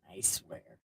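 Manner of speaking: a whispered "I swear" tucked in; sneaky whisper. Acted. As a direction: breathe it like a snake sharing a secret. Serpent's aside to the woman.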